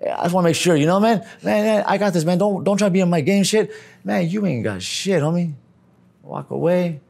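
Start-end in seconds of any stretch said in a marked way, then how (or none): 0.0-5.5 street brother. Retold or imitated. Brother telling the stranger to back off, high pushy voice. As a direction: play the hustler brushing him off. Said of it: "And then goes".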